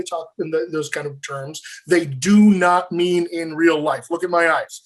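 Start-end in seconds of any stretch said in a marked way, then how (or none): 1.9-4.1 slow, deliberate emphasis on every word; emphatic. Real, not acted. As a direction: drive each word home slowly. Drives home a key warning.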